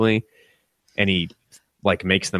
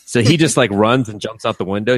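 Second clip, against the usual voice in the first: ordinary delivery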